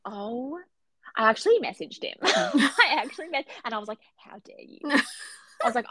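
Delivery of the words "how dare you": whispered, mock-affronted reproach; hushed outrage. Fan scolding the author who upset her, hushed outrage. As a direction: whisper it as if scandalised.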